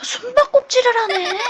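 high-pitched words spilling into laughter; relieved, breaking into a real laugh. Real, not acted. Player realises the game is only hide-and-seek, laughing.

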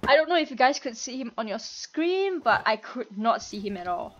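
ordinary talk, nothing marked

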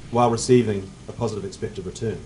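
ordinary delivery

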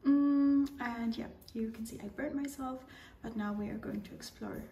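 soft whispered narration, voice barely there; hushed traveller's whisper, confiding to the camera; whispered vlog. Real, not acted. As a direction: whisper so as not to disturb anyone nearby.

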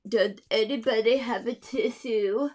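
lightly stuffy-nosed little voice; stuffy flea. Acted. Teacher voicing the stuffy flea asking for a tissue. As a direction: ask as if your nose is blocked. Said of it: "he's very stuffy".

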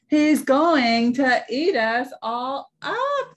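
high, loud, drawn-out mock scream; slow, stretched cry of alarm. A narrator's reading. Dot's friends see the baby wolf, screaming. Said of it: "they screamed".

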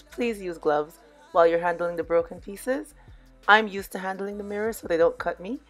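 ordinary talk

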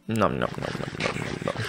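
low, soft "nom nom" chomping; playful eating sounds; mock munching noises. Imitates eating the cooked food.

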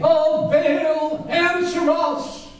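high, drawn-out wailing cry; wailing plea to Baal. Retold or imitated. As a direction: call to Baal in a high, pleading wail. Baal prophets call out.

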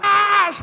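high, loud sustained "ah" yell; imitating cousins yelling on the attack; mock battle scream. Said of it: "mis primos con palos gritaban".

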